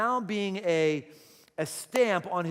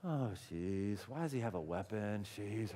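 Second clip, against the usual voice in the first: low, soft, disapproving grumble; hushed mock dismay; mock pacifist; 10 semitones lower, 12 dB quieter than usual. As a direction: play a meek objector, voice sunk low. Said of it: "the pacifists in our midst will go".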